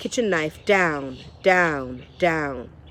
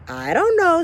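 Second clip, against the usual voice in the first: high, louder whimper; helpless Meg; 10 semitones higher, 5 dB louder than usual. Acted. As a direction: plead not knowing. Meg cowering.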